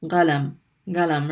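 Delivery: galam with a hard g; North African g. Shown as a demonstration. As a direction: qalam said as galam with a g. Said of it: "pronounced like a ga".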